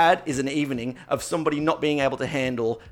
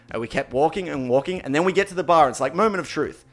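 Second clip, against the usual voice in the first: ordinary delivery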